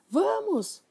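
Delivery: high, loud battle cry; shouting. Acted. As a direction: charge in with a shout. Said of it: "gritou Matilda".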